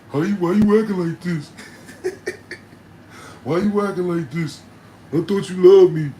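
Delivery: high, loud whiny begging; mock whiny boyfriend; pleading boyfriend. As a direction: beg her with a laugh. Boyfriend begs, laughing.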